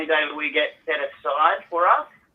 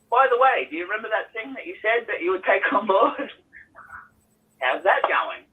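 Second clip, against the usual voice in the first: pitched-up mock innocence, fading to a laugh; mocking sing-song reminder; 7 semitones higher than usual. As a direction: needle her sweetly about the broken promise. Mock reminder to his wife.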